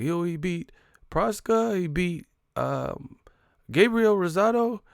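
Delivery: high, slow interviewer impression; Ellie Seckbach. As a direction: imitate a reporter offering names, drawn out. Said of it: "I remember his voice".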